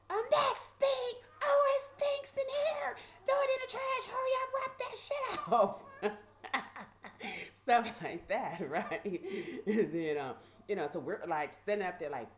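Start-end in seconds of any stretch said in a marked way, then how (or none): 0.1-7.3 high, loud, drawn-out complaint; hollered scolding, very high pitched; imitated yelling. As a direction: yell it like a disgusted guard. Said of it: "some of them were getting yelled at".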